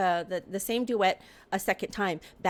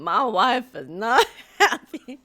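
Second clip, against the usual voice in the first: laughing quote; 8 dB louder than usual. Retold or imitated. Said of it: "the tone in Sugarloaf's voice".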